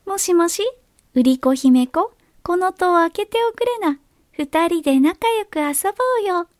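high, sugary coaxing voice; fake cute pouty voice. A narrator's reading. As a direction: wheedle sweetly, luring her. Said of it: "優しい猫なで声を作って".

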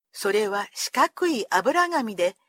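plain reading voice

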